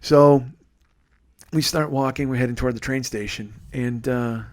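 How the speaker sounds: usual speaking voice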